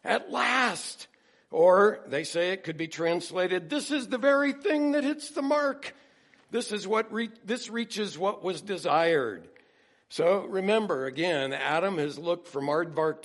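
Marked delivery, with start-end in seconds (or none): 0.0-1.1 high, loud, punched cry; emphatic, exultant outburst; emphatic exclamation. Acted. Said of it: "say it's emphatic". 3.7-9.5 high, loud, astonished delight; elated, raised-voice outburst; exultant exclamation. Acted. Acting Adam's joyous astonishment.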